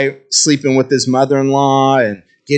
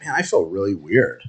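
dazed mutter; 6 semitones lower, 7 dB quieter than usual. Retold or imitated. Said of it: "I'm like".

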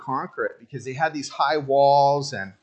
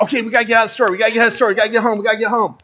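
hurried urging; 5 semitones higher, 5 dB louder, much faster than usual. Retold or imitated. Mimics rushing wife home.

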